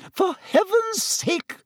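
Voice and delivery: very high, drawn-out hysterical matron. A narrator's reading. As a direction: exclaim hysterically at the top of your range. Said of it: "said Madame Pomfrey hysterically".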